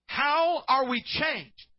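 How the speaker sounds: high, slow, loud preacher call; booming question. Real, not acted. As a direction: draw out the question to the crowd.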